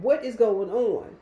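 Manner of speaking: alarmed dispatcher's yell, retold. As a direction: raise the voice and stretch each word, imitating the dispatcher. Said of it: "So Tracy yelled".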